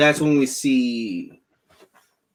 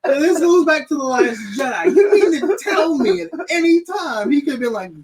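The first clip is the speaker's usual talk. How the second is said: high, loud exasperated shout; outraged rant; 12 semitones higher, 5 dB louder than usual. Real, not acted. Fan ranting to a friend about the plot, shouting. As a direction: yell it out in disbelief.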